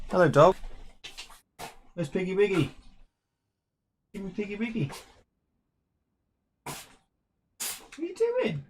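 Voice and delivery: high doting pet voice; talking to the dog. Real, not acted. Cooing at the dog.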